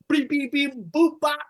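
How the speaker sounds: beep boop noises. Pretend. Imitates fight sound effects.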